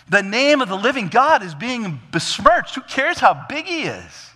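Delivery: high, loud indignation; indignant. Acted. David protesting that God's name is besmirched, indignant. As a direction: raise the pitch and protest hard. Acting out David's outrage at army.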